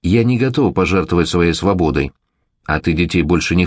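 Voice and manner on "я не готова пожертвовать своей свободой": plain reading voice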